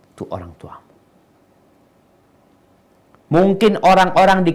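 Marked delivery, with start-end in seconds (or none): 0.1-0.9 hushed whisper, almost no voice; whispered reminder. Real, not acted. Preacher whispering a reminder to the listener, hushed. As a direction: drop to a whisper to drive it home.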